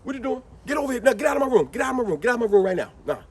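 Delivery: loud, high mock shout; scolding parent. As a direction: chase the kid out of the room. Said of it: "you go".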